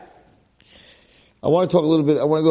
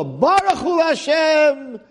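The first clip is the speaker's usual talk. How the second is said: joyful thanks; 8 semitones higher than usual. Retold or imitated. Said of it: "Naomi says to her daughter-in-law".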